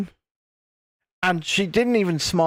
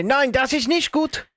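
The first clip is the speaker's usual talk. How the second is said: schoolroom German; 5 semitones higher than usual. Shown as a demonstration. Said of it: "No, you say".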